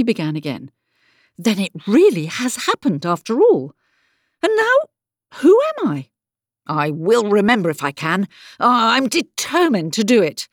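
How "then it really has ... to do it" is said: high, louder questioning that turns resolute; alarmed, then determined. Acted. Alice finding her own name gone, alarmed but determined. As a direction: startle yourself, then insist with effort.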